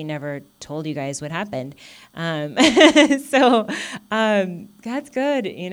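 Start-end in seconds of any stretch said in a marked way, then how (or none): 2.6-3.2 short bright burst of laughter; real laugh. Real, not acted. Speaker laughing with relief to the congregation. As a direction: laugh it out, high and loud. Laughs while sharing good news.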